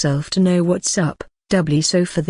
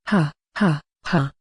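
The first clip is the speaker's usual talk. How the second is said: hearty reassuring laugh; 2 semitones lower, 3 dB quieter than usual. Acted. Orc laughs off the elf's fear.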